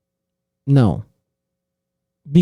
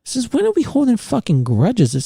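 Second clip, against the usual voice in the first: normal talking voice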